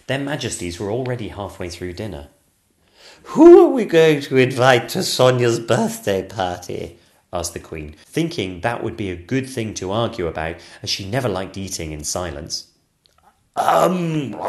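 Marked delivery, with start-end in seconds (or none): 3.2-7.0 loud, slow, bossy queen; haughty queen. Acted. Queen picking an argument. 13.6-14.4 mumbling through a mouthful; mouth full. Acted. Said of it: "said the King with his mouth full".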